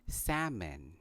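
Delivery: "salmon" as SAM-un; silent L. Shown as a demonstration. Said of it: "So it is pronounced".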